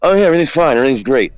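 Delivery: mock everything-is-fine voice; breezy brush-off. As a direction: wave off concern a bit too brightly. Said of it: "And he did the old".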